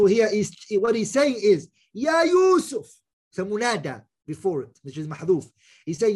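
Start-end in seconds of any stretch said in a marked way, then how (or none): 1.9-2.9 loud call of the name. Shown as a demonstration. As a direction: call to Yusuf from afar. Calling out Yusuf.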